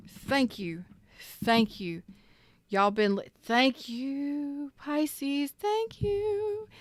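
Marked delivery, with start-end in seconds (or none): 3.4-5.5 high, lilting thank you; sing-song thanks. Real, not acted. 5.5-6.7 high falsetto thanks. Real, not acted. Sing-song thanks.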